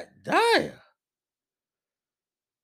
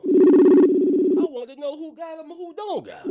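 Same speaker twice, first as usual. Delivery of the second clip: drawn-out loud call; 6 semitones higher, 6 dB louder, slower than usual. Acted.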